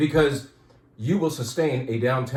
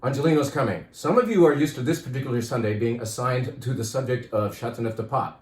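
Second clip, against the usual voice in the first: usual speaking voice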